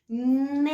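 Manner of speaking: Ne said long and loud; syllable demo. Shown as a demonstration. Teacher shows children the syllable Ne. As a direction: sound out Ne clearly, drawn out. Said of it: "Vamos ver?".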